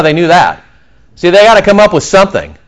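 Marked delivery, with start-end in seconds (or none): none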